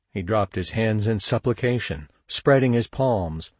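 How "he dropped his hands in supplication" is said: usual speaking voice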